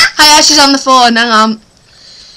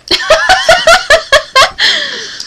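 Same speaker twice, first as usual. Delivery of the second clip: long, high peal of laughter; high-pitched laughing. Real, not acted. Friends laughing while filming.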